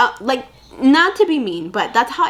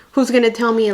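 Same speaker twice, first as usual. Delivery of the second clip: ordinary talk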